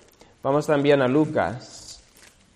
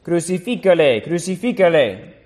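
higher, louder mob cry; crowd shouting; 5 semitones higher, 4 dB louder than usual. A narrator's reading. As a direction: call it out like an angry crowd. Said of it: "dieron voces diciendo".

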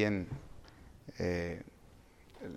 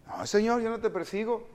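high-pitched denial; protesting believer; 10 semitones higher than usual. Acted. Preacher voices a believer answering the Lord, protesting. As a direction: protest innocence, pitched well up.

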